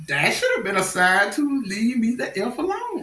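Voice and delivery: ordinary talk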